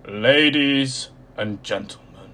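loud, slow, croaky ringmaster greeting; croaky showman voice. A narrator's reading. Mister Tall hails the circus crowd, loud and croaky. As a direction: boom it out to the whole tent like an eerie ringmaster. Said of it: "his voice was low and croaky".

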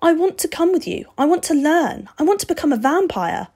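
put-on high, loud, insistent boy; boy Steve, determined. A narrator's reading. Boy begging to go with the vampire, determined. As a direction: press each wish as a determined boy.

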